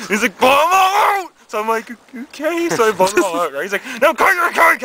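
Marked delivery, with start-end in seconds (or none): 0.4-1.3 high, loud command; dad yelling orders. Acted. Dad orders son to blow out candles, yelling. 2.1-2.7 high, small rising okay; meek reply. Acted. Son answers his yelling dad at the cake, meekly. 4.0-4.8 high, loud command; dad yelling orders. Acted. Dad orders son to cut the cake, yelling.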